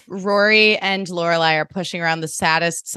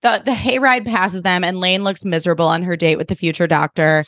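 normal talking voice

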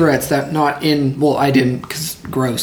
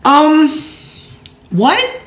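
shocked; 12 semitones higher than usual. Real, not acted. Shocked reaction.

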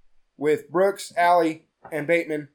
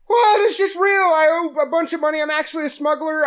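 panicked confession; 9 semitones higher than usual. Retold or imitated. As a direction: gush it out in panic, faster at the end. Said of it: "Brooks is like".

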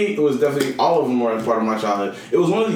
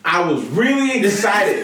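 higher, louder, slower, drawn out; excited shout; 9 semitones higher, 3 dB louder than usual. Real, not acted. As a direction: protest loudly with excitement. Defending himself, shouting laughingly.

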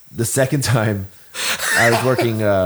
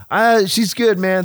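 playing it off; 9 semitones higher, 4 dB louder, slower than usual. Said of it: "and I'm just like".

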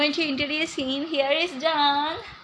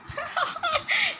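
high burst of giggling; high giggle; 3 dB quieter than usual. Real, not acted. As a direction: squeal a short laugh.

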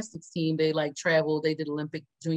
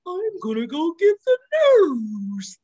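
alarmed slow voice; 10 semitones higher, 6 dB louder, slower than usual. Retold or imitated. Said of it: "He's like".

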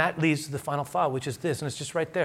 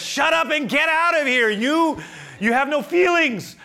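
hammed-up yell at a clueless husband; mock shouting wife; 7 dB louder than usual. His reading of wife's meaning.

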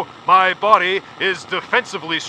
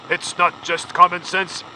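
slow pompous delivery; slower than usual. Acted.

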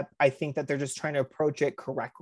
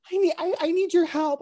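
high-pitched mock plea for help; imitated pleading, pitched way up; mock girlish plea; 11 semitones higher, 3 dB louder than usual. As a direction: put on a high, needy voice, imitating. Acting out girls asking for help.

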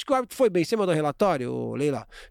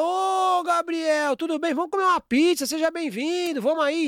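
much higher, beaming greeting; warm welcome; 9 semitones higher than usual. Retold or imitated. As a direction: call out happily to a guest. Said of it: "Agora, eu abro a porta e falo".

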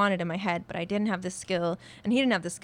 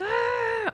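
stressed-out cry; 4 dB louder than usual. Retold or imitated. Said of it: "I feel like I'm like".

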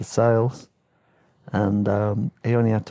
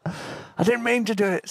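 imitating Gordon; 10 semitones higher than usual. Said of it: "said Gordon".

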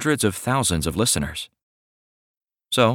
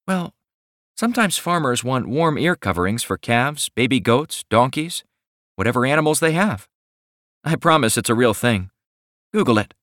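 higher, sweet shy woman's voice; shy young woman; 5 semitones higher than usual. Acted. Millie explains her knitting.

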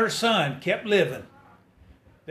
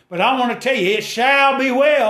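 rising, louder preacher's call; climbing gospel preacher cadence; preaching at full force; 6 semitones higher, 4 dB louder than usual. Acted. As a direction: lift the congregation up. Preacher builds to a shout.

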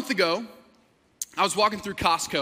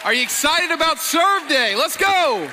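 fired up; 3 semitones higher, 5 dB louder than usual. Real, not acted. Pastor rallying church for Serve Day, fired-up shout. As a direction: pump up the whole crowd. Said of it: "I'm fired up. I'm pumped up".

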